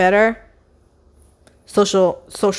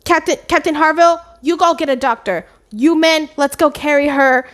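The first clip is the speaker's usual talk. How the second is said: raised, urgent commanding voice; taking charge; 6 semitones higher than usual. Retold or imitated. Anne ordering the men to help, urgent command. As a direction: hand out orders, pitched up and firm.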